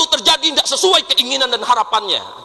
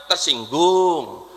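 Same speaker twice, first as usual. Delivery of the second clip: slow low sulky "Tersinggung"; mock sulky tersinggung; 6 semitones lower, much slower than usual. Mock answer, drawn out.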